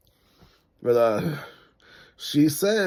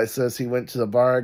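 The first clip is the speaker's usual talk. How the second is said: ordinary talk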